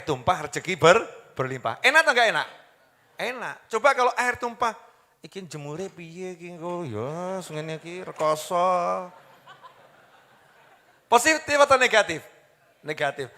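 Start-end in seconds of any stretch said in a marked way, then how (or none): 5.2-9.1 retold grumbling. Acts out negative grumbling over spill.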